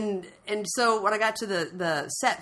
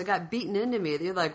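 usual speaking voice

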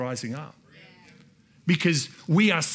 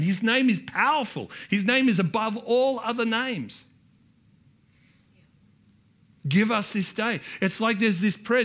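raised, fervent preaching; preaching at full force; 5 semitones higher than usual. Acted. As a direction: drive it home to the congregation. Preaching builds.

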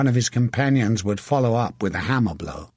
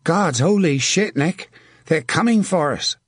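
higher-pitched nervous alarm; 5 semitones higher than usual. A narrator's reading. Archer warning his friend of the attack, nervous. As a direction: warn your friend with jittery urgency. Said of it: "Tom Scarlett said nervously".

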